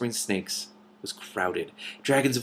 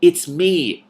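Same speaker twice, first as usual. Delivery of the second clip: louder, higher, urgent plea; desperate plea; 9 semitones higher, 9 dB louder than usual. A narrator's reading. Princess pleading with a doubting dragon, desperate. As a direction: beg to be believed. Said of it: "Cimmerian said desperately".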